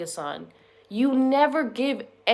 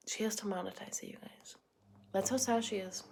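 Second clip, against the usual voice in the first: mock whisper; 13 dB quieter than usual.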